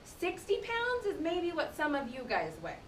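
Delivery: ordinary delivery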